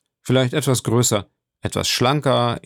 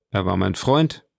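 plain reading voice

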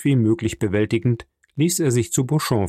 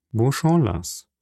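plain reading voice

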